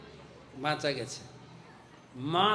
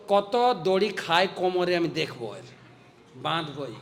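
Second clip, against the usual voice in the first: angry mother. Retold or imitated. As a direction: snap back like a mother losing her temper. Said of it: "মা আরো রেগে গেছেন".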